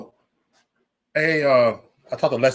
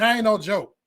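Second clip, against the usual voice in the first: ordinary talk, nothing marked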